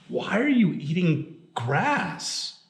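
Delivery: slow, high, baffled question; storyteller's incredulous businessman; puzzled question. A narrator's reading. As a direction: ask in drawn-out puzzlement, pitch jumping up. Businessman questions men eating grass.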